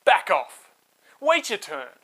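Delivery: loud shout at the bullies. Acted. Moses shouting at shepherds chasing the sisters away, loud. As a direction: drive the shepherds back with a yell.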